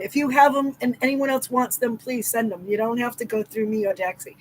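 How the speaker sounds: ordinary talk, nothing marked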